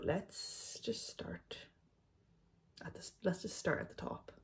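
soft unvoiced whisper. Real, not acted. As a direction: whisper it, barely any voice. Whispered start of questions.

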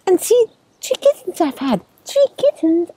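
high, excited cat voice; storybook mama cat, high-pitched; mother cat. A narrator's reading. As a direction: announce it high and proudly. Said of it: "Sock said".

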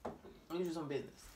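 quiet muttered telling-off. Real, not acted. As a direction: drop to an aside. Repeated much softer.

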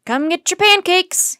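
loud, high, drawn-out breakfast call. A narrator's reading. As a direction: call everyone over to eat, slowly and loudly. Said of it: "he called to Jack and Annie".

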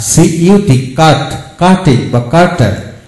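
usual speaking voice

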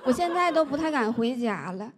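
usual speaking voice

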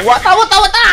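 high rapid-fire kung fu yelps; playful battle cry. Pretend. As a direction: yelp it high like a fighter. Playful fighting cries in game. (pabirong sigaw ng mandirigma)

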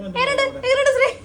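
high, loud show-off question; calling out. Real, not acted. Showing off makeup to a friend, high excited call. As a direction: call it out high, fishing for a verdict.

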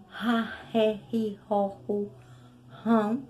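ra, ré, ri, ro, ru, rão each with a throaty r; strong r syllables. Shown as a demonstration. Teacher recites the strong r syllables for the class. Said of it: "é porque o R é duplo".

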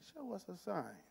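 sudden soft deadpan; deadpan demand. Acted. Disciples answering Jesus's big claim, quiet deadpan. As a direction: drop to a quiet, understated ask.

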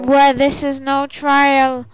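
high, loud, slow intoning; ethereal voice. Acted. Spirit voice announcing the trial, ethereal chant. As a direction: proclaim it slowly as an otherworldly oracle. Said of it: "you hear an ethereal voice".

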